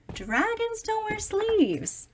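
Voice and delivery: ordinary delivery, nothing marked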